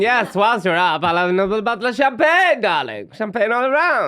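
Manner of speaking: a hint of a grand lady demanding champagne; mock posh parent. Mocking posh parent ordering champagne.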